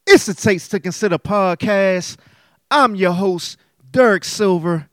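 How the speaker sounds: loud, high hype intro voice; podcast announcer. Acted. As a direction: boom out the show opener.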